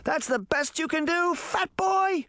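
high-pitched taunting yell; taunting shout. A narrator's reading. Puck jeering at his attacker, shouting high. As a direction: shout it high, like a cocky challenge. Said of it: "Puck shouted".

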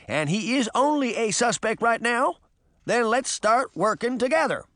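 exasperated but firm address; Hampstead, firm leader. Acted. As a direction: take charge of the squabbling team.

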